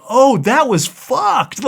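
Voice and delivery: slow, high dawning shock; slow, high, dismayed realization years later; belated realization. Retold or imitated. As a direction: stretch it out as it finally sinks in. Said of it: "you're like".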